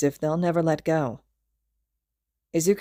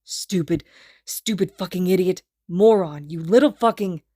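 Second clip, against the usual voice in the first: louder scolding into his hair; growling obscenities; 2 semitones higher, 5 dB louder than usual. A narrator's reading. Kacchan hugs Izuku and curses him, growling. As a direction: swear at him while hugging. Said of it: "growling obscenities".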